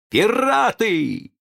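drawn-out high alarmed cry; alarmed exclamation. A narrator's reading. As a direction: sound the alarm, slow and high. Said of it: "а потом воскликнул".

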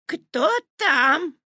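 put-on high quavering old woman; old granny calling out; grandma voice. A narrator's reading. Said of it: "спросила бабушка".

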